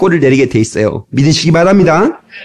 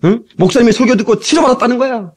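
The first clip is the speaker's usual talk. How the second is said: ordinary delivery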